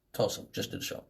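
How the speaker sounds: barely audible whisper; hushed mumbled reply; whispered answer. Retold or imitated. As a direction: murmur it so the cop barely hears. Answering police quietly.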